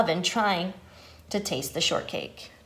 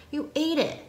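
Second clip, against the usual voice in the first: high-pitched, mildly acted accusation; accusing cry; 6 semitones higher than usual. A narrator's reading. Iguana blaming Pig for eating the cake, accusing cry. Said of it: "cried Iguana".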